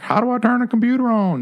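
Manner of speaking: high-pitched, put-on baffled oldster; mock older person. Podcast host mocking older folks at computers, mock older person. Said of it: "these older folks are like".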